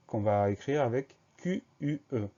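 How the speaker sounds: normal talking voice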